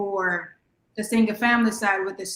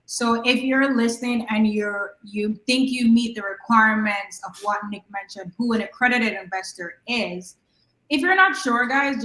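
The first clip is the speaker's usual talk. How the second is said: ordinary talk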